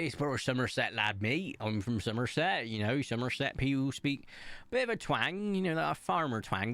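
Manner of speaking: broad Somerset farmer twang; Somerset accent. Shown as a demonstration. Vlogger showing the accent they grew up around, Somerset farmer twang. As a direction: talk like a Somerset lad with a country twang. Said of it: "if I spoke with my accent".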